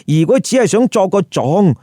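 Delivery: usual speaking voice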